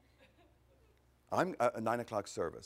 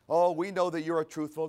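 louder, buttering-up sweet talk; mock flattery; 4 semitones higher, 7 dB louder than usual. Pharisees buttering up the teacher, mock flattering. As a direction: lay the flattery on thick, louder. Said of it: "this is a good way to butter him up".

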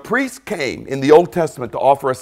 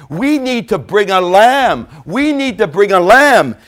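higher, louder insistent urging; preaching emphasis; 5 semitones higher, 4 dB louder than usual. Acted. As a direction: press the point home with rising force.

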